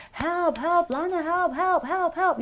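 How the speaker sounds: high calls at ordinary volume; retold cries for help. Woman retelling banging on neighbor's door, high pleading calls. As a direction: re-enact calling a neighbor for help, urgent but restrained.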